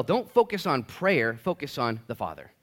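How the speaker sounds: ordinary delivery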